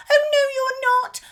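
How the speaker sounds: very high, loud whispered squeak; a tiny goat's nervous protest; little goat defying the troll, squeaking; squeaky goat. A narrator's reading.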